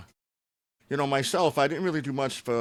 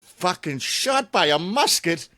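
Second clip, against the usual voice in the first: slow, incredulous mock British accent; a put-on Brit in disbelief; 5 semitones higher, 5 dB louder, slower than usual. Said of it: "why I went with a British accent".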